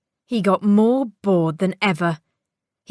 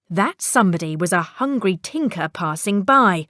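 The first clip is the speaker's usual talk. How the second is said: usual speaking voice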